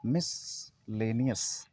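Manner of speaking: "मिसलेनियस" very slow and soft, split mis / lay-ni-yas; correct miscellaneous. Shown as a demonstration. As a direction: break "मिसलेनियस" after mis, low and drawn out. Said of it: "आप इसको पढ़ेंगे मिसलेनियस". ("मिसलेनियस" का सही उच्चारण, बहुत धीरे, तोड़कर)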